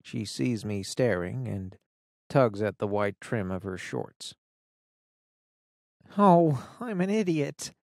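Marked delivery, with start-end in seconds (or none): 6.2-7.7 lightly voiced teen friend, a touch flustered; a hint of embarrassment, higher; embarrassed girl. Fiona embarrassed.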